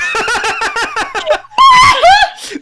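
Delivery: shrieking laughter; loud high laugh. Real, not acted. Big laugh at punchline.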